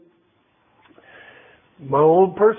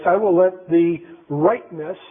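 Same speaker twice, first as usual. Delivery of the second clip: ordinary delivery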